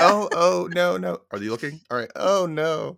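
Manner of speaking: hammed-up "oh no", pitched high; badly faked alarm, checking for an audience; cartoonish fake distress. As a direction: play-act distress like a cartoon. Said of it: "like, cartoonish, like, "Are they buying it?".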